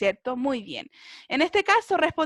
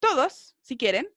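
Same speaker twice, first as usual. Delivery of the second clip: normal talking voice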